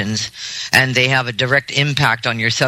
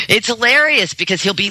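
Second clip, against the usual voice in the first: usual speaking voice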